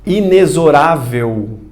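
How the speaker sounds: "Inexorável" slowly, with x as z: inezorável; pronúncia exata. Shown as a demonstration. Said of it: "como é que se pronuncia". ("Inexorável" devagar, com x de z)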